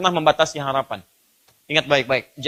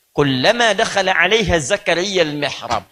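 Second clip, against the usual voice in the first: measured Arabic recitation; Quran recitation. Real, not acted. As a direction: recite the verse with drawn-out syllables.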